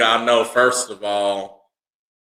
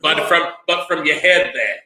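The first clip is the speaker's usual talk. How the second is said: higher-pitched Aussie clarification; Australian accent; 4 semitones higher than usual. Acted. Correcting his friend's crude guess, in Australian accent. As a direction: explain it like an Australian. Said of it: "why I like that accent".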